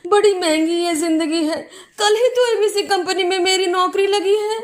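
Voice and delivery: high, wailing plea of a drowning man; acted desperate cry. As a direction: wail pitifully, hammed-up and tearful. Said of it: "चिल्ला रहा था". (डूबते आदमी की ऊँची, रोती हुई गुहार)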